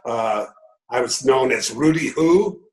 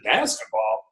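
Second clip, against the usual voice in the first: put-on elderly lady; 10 semitones higher than usual. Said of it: "She said".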